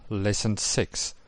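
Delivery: usual speaking voice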